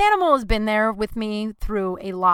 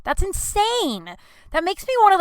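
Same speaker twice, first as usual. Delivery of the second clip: indignant; 12 semitones higher, 5 dB louder than usual. Real, not acted. Indignant at vet policy.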